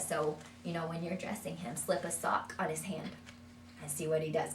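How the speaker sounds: ordinary talk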